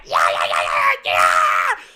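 loud, raw repeated yell; manic mock screaming. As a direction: scream it out like someone berserk. Acts out wild coked-up screaming.

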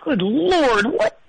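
muffled into a pillow; pillow over face. Real, not acted. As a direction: groan into a pillow. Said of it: "I got my face down in a pillow?".

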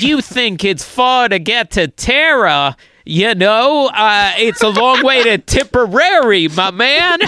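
hammed-up New York wise guy joking with a pal; mock cartoon New York voice; Rocket, New York. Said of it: "Universal Hollywood New York's".

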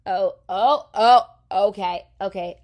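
loud startled oh's; startled backing off. Retold or imitated. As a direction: flinch back in alarm. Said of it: "I said".

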